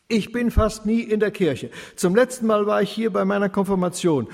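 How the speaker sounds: laut und deutlich höher, aufgebracht; erregt. A narrator's reading. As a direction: sich hitzig rechtfertigen. Sauter erklärt sich erregt. (Sauter erklärt dem Pfarrer aufgebracht seinen Kirchenbesuch)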